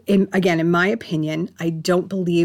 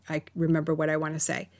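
ordinary delivery

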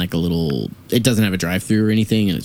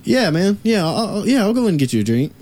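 eager agreeing; 10 semitones higher than usual. Retold or imitated. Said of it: "I'm like".

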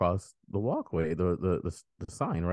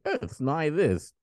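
slow, high-pitched, lightly mocking entitlement; mock entitled; 8 semitones higher, slower than usual. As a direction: draw it out like someone staking a petty claim. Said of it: "entitled to be like".

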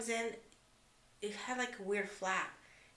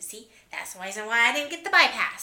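louder, higher smug jab; smug told-you-so; 4 semitones higher, 11 dB louder than usual. Retold or imitated. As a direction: gloat to her husband, a bit louder. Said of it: "then she told her husband".

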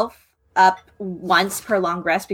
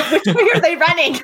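laughing; 8 semitones higher than usual. Real, not acted.

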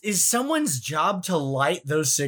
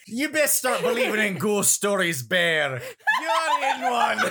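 hammed-up Scottish movie threat, laughing through it; Scottish accent pitched up, breaking into laughter; mock-menacing Scottish line, higher and giggly; 6 semitones higher than usual. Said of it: "Maybe [name] can".

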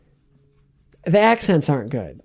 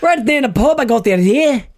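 put-on Irish accent; 11 semitones higher, 4 dB louder than usual. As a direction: play Irish Nick Fury in the pub. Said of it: "The Irish version".

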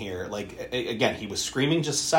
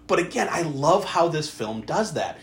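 ordinary delivery

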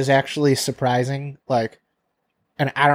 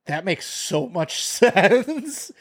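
laughing; 5 semitones higher, much slower than usual. Retold or imitated. Realising the bottle was mislabeled.

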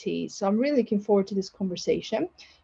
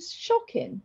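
usual speaking voice

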